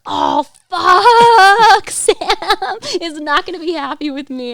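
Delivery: drawn-out high yell easing into laughing dread; retold panic. Oversleeper realizing Sam will be unhappy, hammed-up panicked wail. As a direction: wail the curse loudly, then come down. Retelling waking up late in panic.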